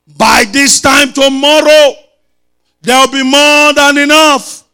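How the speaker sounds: loud, high prophetic declaration; forceful preaching. Real, not acted. Preacher proclaiming a prophecy to the congregation, forceful preaching. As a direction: proclaim it loudly, drawing the words out.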